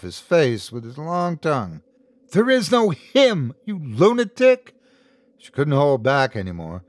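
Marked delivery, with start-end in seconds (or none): 2.3-4.7 high, exasperated angry cry; furious. A narrator's reading. Woman fighting a deranged man, high furious cry. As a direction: hurl it out high.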